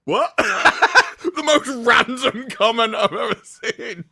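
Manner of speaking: real laughter through high, loud words; laughing. Real, not acted. Host retelling a random soup comment, laughing hard. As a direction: crack up while telling it.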